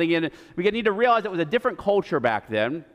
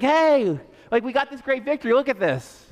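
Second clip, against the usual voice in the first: a lightly voiced eager Saul, pitched up; excited Saul; 6 semitones higher than usual. Saul greets the prophet, lightly voiced eager boast. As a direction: show off the win like an eager kid. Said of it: "Saul's excited that Samuel's there".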